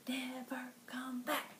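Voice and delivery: slow hushed whisper; spooky whispered spell ending. Acted. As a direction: breathe it out as the spell's end. End of chant.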